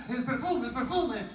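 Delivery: high, urgent repeated words; nervous searching. Acted. Woman hunting for her perfume, acted nervousness. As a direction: imitate a flustered woman hunting for something. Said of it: "sigue buscando así nerviosa".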